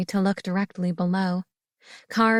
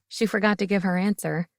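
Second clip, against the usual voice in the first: plain reading voice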